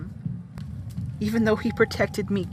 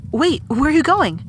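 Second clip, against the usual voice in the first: alarmed call; 5 semitones higher, 5 dB louder than usual. Acted. Friend suddenly walks off.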